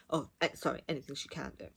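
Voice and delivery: ordinary talk, nothing marked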